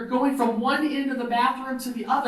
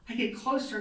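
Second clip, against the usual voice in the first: usual speaking voice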